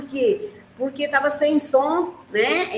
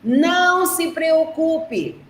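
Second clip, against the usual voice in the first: slow, careful quote from the book; teacher quoting the book's words to her pupils; quoting the text; 5 semitones higher, slower than usual. Shown as a demonstration. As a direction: read the line out slowly for the kids. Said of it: "Quando ele fala assim, ó".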